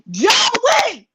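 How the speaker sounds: high shouted name through a laugh; calling out laughing. Retold or imitated. Bouncer retelling calling the manager, laughing and loud.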